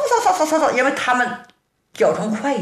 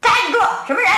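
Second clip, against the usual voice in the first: shouting halt; 8 semitones higher, much faster than usual. A narrator's reading. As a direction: bark it after a fleeing figure. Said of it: "一边追一边喊".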